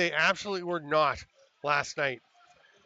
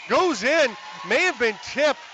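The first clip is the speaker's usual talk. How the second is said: higher and louder, shouting the goal; excited goal call; 6 semitones higher, 5 dB louder, slower than usual. Real, not acted. Goal call in live commentary.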